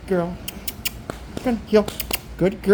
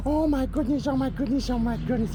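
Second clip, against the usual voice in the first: high, soft gushing; talking to a dog; 7 semitones higher, 6 dB quieter than usual. Real, not acted. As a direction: gush over the dog sweetly. Fussing over the dog.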